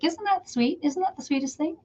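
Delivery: high, soft cooing; touched gushing. Real, not acted. Sharing a customer's sweet compliment with viewers, cooing.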